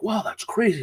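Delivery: retold amazement. Retold impressed reaction to joke.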